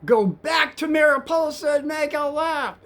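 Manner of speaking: high, loud, drawn-out yell imitating a passenger; retold screaming. Irate passenger ordering the cab driver to turn, screaming. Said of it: "he starts screaming".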